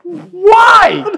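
very high, very loud laughing cry; real exasperated yell; incredulous shout. Real, not acted. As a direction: shriek it in amused disbelief.

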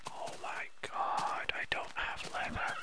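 a soft, urgent whisper; hushed whisper. Real, not acted. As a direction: whisper so the animal won't hear. Whispering upon spotting the unicorn.

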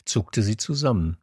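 usual speaking voice

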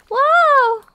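high, loud sudden whoa; startled shout. Real, not acted. Player startled as the ground collapses. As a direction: caught off guard by the floor giving way.

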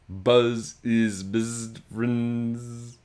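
very slow, drawn-out buzzing fly voice; slow buzzy fly. A narrator's reading. Fly telling his boy they are best friends, slow and buzzy. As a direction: stretch each word like a sleepy fly.

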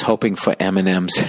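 ordinary talk

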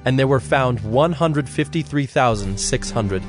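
normal talking voice

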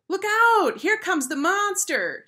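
high, loud schoolboy scream; shrieked, drawn-out alarm; screamed warning. A narrator's reading. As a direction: scream in mock terror to the playground. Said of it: "screamed a chubby fourth grade boy".

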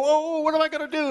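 high, fast mock panic; panicky cry. Preacher mimics a worried believer, high mock panic. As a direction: squeal it out like a frightened believer.

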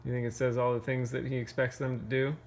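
ordinary delivery, nothing marked

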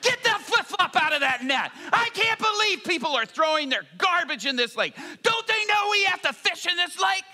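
high-pitched, hammed-up angry rant; frustrated yelling. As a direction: yell like an exasperated fisherman at the end of his rope. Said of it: "he's getting frustrated".

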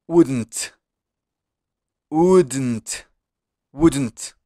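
"Wouldn't" with the U said as if you had a cold, then a D and a T; stuffy-nosed wouldn't. Shown as a demonstration. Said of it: "como si estuvieras agripado, con resfriado". ("Wouldn't" con la U como si estuvieras agripado)

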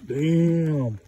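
real amazement, called out loud; excited loud. Real, not acted. Holding the crab up close.

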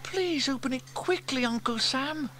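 put-on high child voice, pleading; high-pitched childish plea; Sarah begging. A narrator's reading. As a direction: beg like an eager little niece. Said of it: "begged Sarah".

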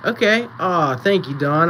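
normal talking voice

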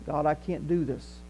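ordinary talk, nothing marked

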